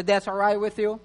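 ordinary delivery

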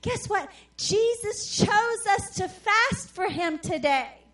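put-on high, bright coaxing to children; mother cheering her hungry kids; excited mother. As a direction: sell hunger as a treat. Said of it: "I get them together and I said".

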